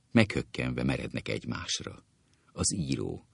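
ordinary talk, nothing marked

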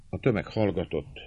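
ordinary delivery, nothing marked

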